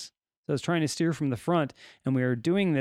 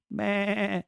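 higher imitated lamb bleat; sheep bleat; 4 semitones higher than usual. Imitates the rogue lamb's bleat.

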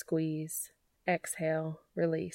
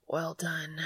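breathless; 4 dB quieter than usual. A narrator's reading. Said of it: "he said breathlessly".

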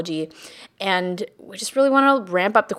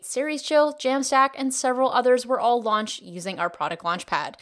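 ad read; 5 dB quieter than usual. Acted. Recorded ad read.

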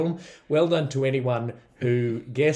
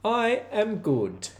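put-on Welsh accent; 5 semitones higher than usual. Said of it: "try the Welsh accent".

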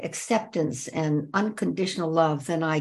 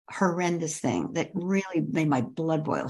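usual speaking voice

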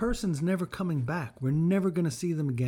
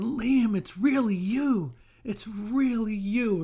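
joyful reunion cry; 7 semitones higher, 5 dB louder, slower than usual. Retold or imitated. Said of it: "I picture myself running up to him".

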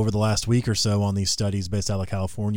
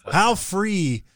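FREE mockingly stressed and pitched high; mock-stress free sky-high with sarcasm; sarcastic emphasis; 9 semitones higher than usual. Real, not acted. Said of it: "I'm being sarcastic here".

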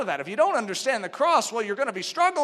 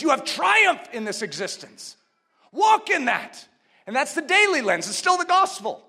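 higher and louder, exhorting; preaching at full force; 5 semitones higher, 4 dB louder than usual. Acted. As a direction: stir them to walk in triumph.

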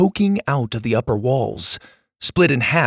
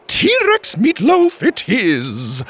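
high, slow, sing-song cook; decide it for him, singing it out; 12 semitones higher, 5 dB louder, much slower than usual. Acted. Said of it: "sing-song voice".

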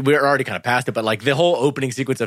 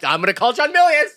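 mock angry parent; 13 semitones higher, 4 dB louder, faster than usual.

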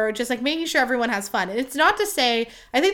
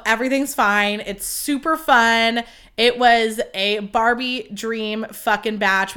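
normal talking voice

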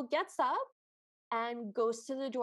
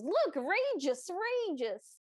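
higher, drawn-out swooping exclamation; outraged troll; 6 semitones higher, slower than usual. Acted. Troll distracted by the majesty of the palace, exclaiming. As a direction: stretch each word in hammed-up troll amazement. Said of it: "as an outrageous, I guess".

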